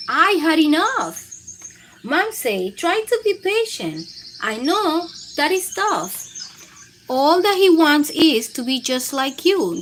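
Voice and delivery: put-on lively read-aloud to children; animated storybook narration; picture book reading. A narrator's reading. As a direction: read it like bedtime story time for kids.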